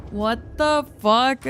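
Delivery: normal talking voice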